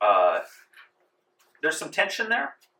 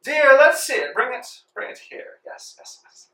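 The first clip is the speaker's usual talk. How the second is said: a shrill-pitched rich madam calling out; haughty lady; 14 semitones higher, 8 dB louder than usual. Acted. The rich lady calls to her maid in a high grand voice. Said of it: "and she goes".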